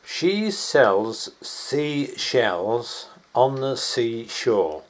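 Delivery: very slow, drawn-out words; slow tongue twister. Shown as a demonstration. Man reciting a tongue twister to be sped up, slowly.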